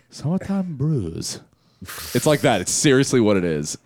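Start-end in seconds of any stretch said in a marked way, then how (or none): 0.1-1.4 slow mock accent on "Summertime Brews"; retelling the band's joke in a slow put-on accent. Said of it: "racist accent".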